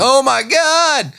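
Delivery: slow, high, loud gasp of dismay; overdone appalled exclamation; mock shock. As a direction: drag it out in comic horror. Joins the acted shock.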